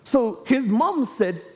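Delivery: usual speaking voice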